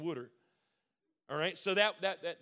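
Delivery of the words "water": "wooder" for water, rhyming with "should er"; "wooder" with the vowel of "wood"; Maryland pronunciation. Shown as a demonstration. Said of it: "People make fun of us saying wooder".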